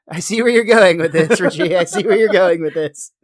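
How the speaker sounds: amused. Real, not acted. Laughs at co-host's line of argument.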